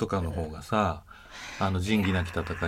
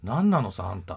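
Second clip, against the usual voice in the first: loud Hokkaido-dialect "なんなのさ、あんた"; angry Hokkaido dialect; 5 dB louder than usual. Shown as a demonstration. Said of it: "北海道弁で言うと".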